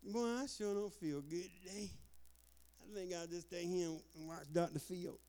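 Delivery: soft, sluggish put-on voice; mock a half-hearted excuse to stay home; imitated lazy excuse-maker. Preacher mocking Israelites skipping the assembly, listless put-on voice. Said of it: "they didn't say".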